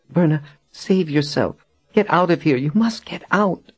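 high-pitched, urgent pleading; urgent plea. A narrator's reading. Shivering captive begs her friend to save herself, urgent. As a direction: urge her to flee in a high voice. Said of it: "she whispered urgently".

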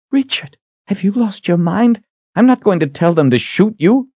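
high female character voice, incredulous; alarmed woman. Acted. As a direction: protest in high-pitched disbelief. Kalen protests to Richard.

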